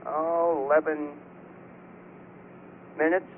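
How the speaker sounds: ordinary delivery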